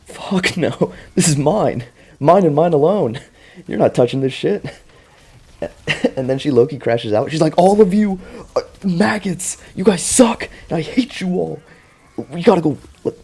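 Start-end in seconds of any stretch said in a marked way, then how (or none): 0.1-4.6 high, loud, hammed-up greedy shout; mock possessive Lily. Retelling Lily refusing to share dagger, hammed-up high shout. 7.6-13.2 much higher, loud hammed-up rant; mock furious Lily. Lily crashes out at her friends, high loud mock rant. Lily voiced insulting the group.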